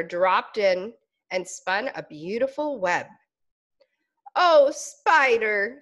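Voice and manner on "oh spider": slow, longing sigh of a word; wistful sigh. Acted. Caterpillar sighing at spider's web, slow and longing. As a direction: breathe it out in longing. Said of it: "sighed Caterpillar".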